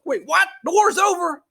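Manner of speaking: high, incredulous squeak; mock disbelief. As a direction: sound stunned the war is over.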